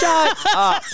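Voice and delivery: high, drawn-out shut up, fed up; exasperated shout. Real, not acted. Co-host mocking a cheesy Instagram post, exasperated high shout. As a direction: yell it up high in disgust.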